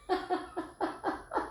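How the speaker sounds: airless high wheezing laugh; silent wheeze laugh. Real, not acted. Tarot reader cracking up, wheezing silent laugh. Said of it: "I'm laughing because".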